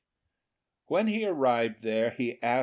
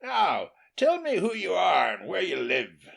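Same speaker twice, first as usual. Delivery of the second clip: loud gruff demand, raised; demanding peasant; 7 semitones higher, 4 dB louder than usual. A narrator's reading. Peasant loudly demands to know who his guest is. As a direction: order the guest to explain himself. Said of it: "said the peasant".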